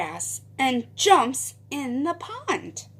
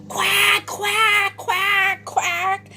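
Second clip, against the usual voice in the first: high, loud quacking duck voice; raucous quacks and a high greeting; shouting duck; 8 semitones higher, 5 dB louder, slower than usual. A narrator's reading. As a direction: blare it out as a noisy cartoon duck. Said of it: "shouts Dottie".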